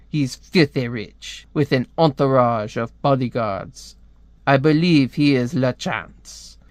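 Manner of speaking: laboured put-on French accent. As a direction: deliver a spy briefing in a mock French accent. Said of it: "I hate doing a French accent".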